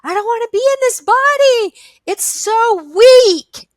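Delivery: high, loud wail of protest; screaming. Retold or imitated. Reliving her hypnosis session for the listeners, screaming. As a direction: scream it out, dragging out the last words. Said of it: "And I was screaming".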